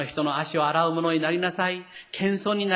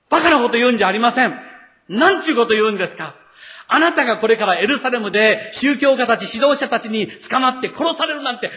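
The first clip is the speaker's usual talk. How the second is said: loud, fast rebuke, higher; scolding; 5 semitones higher, 6 dB louder than usual. Retold or imitated. Peter rebuking Jesus for predicting his death, loud fast scolding. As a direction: tell him off like a scolded child. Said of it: "イエス様を叱ったのです。".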